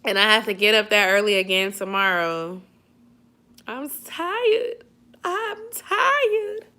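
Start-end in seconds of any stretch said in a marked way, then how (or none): 3.7-6.7 high, whiny sleepy whine; high whiny tired. Pretend. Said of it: "I am tired".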